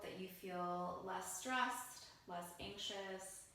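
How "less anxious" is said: normal talking voice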